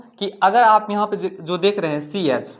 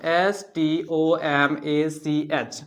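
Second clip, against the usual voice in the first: drawn-out classroom spelling; slow spelling; 2 semitones lower, much slower than usual. Shown as a demonstration. Teacher writing stomach on board, spelling slowly. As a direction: spell stomach out one letter at a time, very slowly. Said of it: "हम लिखते हैं यहाँ पे stomach".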